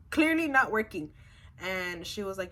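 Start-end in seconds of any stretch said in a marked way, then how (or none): 0.1-1.1 louder, higher retold protest; exasperated complaint. Patient fed up with her nurse, exasperated. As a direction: stress your frustration, pushing the volume up.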